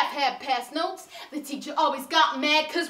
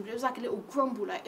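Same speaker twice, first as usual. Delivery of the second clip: usual speaking voice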